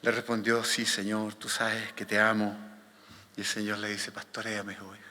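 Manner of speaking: low, soft Gospel reading; solemn scripture reading. A narrator's reading. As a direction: read it hushed and low. Reads the Gospel passage aloud. (lectura bíblica solemne, grave y suave)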